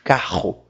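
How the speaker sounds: "Carro" with the R vibrating deep in the throat; low-pitched "Carro", R buzzing at the back; guttural R. Shown as a demonstration. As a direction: make the R of "Carro" rumble in the throat. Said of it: "Você sente vibrar aqui embaixo".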